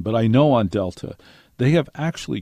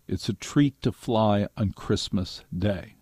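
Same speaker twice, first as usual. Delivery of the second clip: ordinary talk, nothing marked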